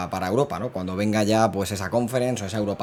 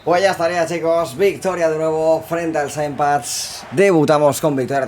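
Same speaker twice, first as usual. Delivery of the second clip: excited victory call; 5 semitones higher, 5 dB louder than usual. Real, not acted. Announces the final win.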